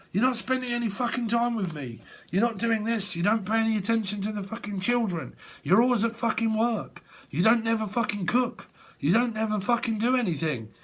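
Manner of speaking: high-pitched mock nag, rattling off complaints; mock nagging girlfriend. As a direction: squeak out a partner's endless complaint list.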